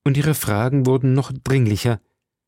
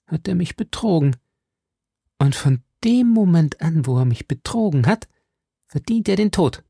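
higher-pitched grave verdict; ernst; 5 semitones higher than usual. A narrator's reading. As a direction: pronounce it in deadly earnest. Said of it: "sprach Milady ernst". (mit ernstem Nachdruck)

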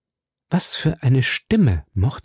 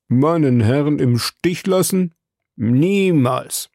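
drawn-out, emphatic refusal; loyal servant, indignant; 4 semitones higher, slower than usual. A narrator's reading. Servant refusing to abandon his master, indignant. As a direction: voice a devoted servant, shocked at the idea. Story narrator voices servant Conseil.

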